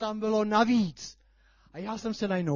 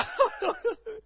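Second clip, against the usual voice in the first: delighted laugh. Real, not acted. After the shouted hurrah.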